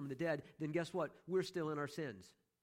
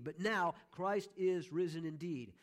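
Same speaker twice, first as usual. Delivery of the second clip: NOW punched loud, then IS stressed slowly; emphatic; 2 semitones higher, 3 dB louder, slower than usual. Shown as a demonstration. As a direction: hit "now" hard, then slow down on "is". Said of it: "that emphasis there".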